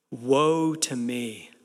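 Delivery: slow, low, solemn lament; acted awe-struck despair; humbled dread. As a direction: draw it out gravely, as one humbled to the core. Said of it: "he is humbled to the core".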